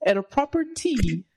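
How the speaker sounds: high-pitched fake British; playful put-on British lilt; mock British accent. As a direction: finish in a hammed-up British accent. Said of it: "We're working on the accent".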